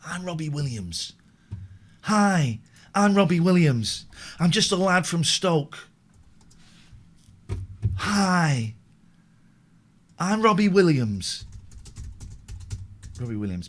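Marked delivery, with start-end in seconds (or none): 0.0-11.4 lightly put-on lad voice, cheery hi; just a hint of a chirpy Stoke lad. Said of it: "let me find out how [name] talks".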